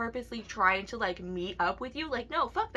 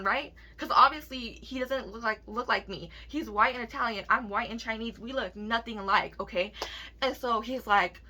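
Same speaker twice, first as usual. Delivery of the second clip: ordinary delivery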